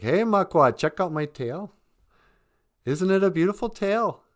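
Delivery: high, loud show-off voice; boastful beaver. Acted. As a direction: strut and brag about that tail. Beaver brags to the bear.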